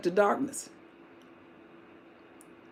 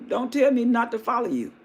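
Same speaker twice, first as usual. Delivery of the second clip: normal talking voice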